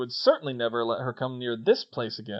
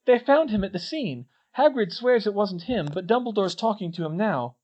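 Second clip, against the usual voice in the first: higher, louder, pressing delivery; urgent report; 7 semitones higher, 5 dB louder than usual. Acted. Katie telling Harry's friends, urgent. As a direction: rush out the alarming news.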